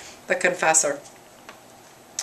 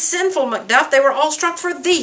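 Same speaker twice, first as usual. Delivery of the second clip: higher-pitched acted self-reproach; distraught; 7 semitones higher than usual. Macduff blames himself for his family's deaths, mildly acted distraught. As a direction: reproach yourself in mild anguish, voice raised in pitch. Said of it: "totally distraught".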